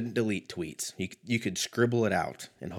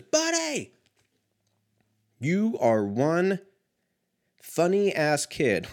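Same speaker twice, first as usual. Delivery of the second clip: enthusiastic read-aloud; 8 semitones higher, much slower than usual. Retold or imitated. Reading enthusiastic yearbook note.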